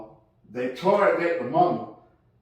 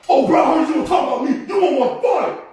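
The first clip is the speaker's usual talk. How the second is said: put-on high, loud, fast drunken shouting; drunk angry bro; 10 semitones higher, 8 dB louder, faster than usual. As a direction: yell at him like a raging drunk. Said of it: "bro called me".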